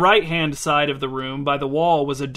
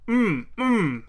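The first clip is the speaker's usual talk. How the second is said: higher mm mm hummed through shut lips; closed-mouth mumble; 6 semitones higher than usual. Acted. Fedya refusing to open his butter-filled mouth, mumbling. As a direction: hum it with lips pressed on a mouthful.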